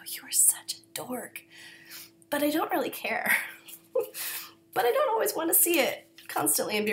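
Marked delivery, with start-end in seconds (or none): 0.1-1.4 hushed, teasing herself; self-mocking whisper. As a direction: whisper it at yourself in mock disgust. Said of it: "I watch myself and I go".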